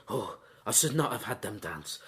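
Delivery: low, soft, rueful sigh of a line; regretful lament. Retold or imitated. Vladimir regretting the dance, in a low, soft lament. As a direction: mourn the mistake quietly, voice dropped well down. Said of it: "Vladimir actually says".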